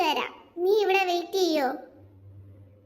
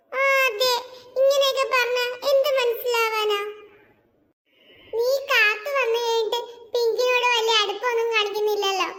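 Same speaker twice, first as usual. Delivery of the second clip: scolding; 4 semitones higher than usual. Acted. Jeena scolding Twinkle.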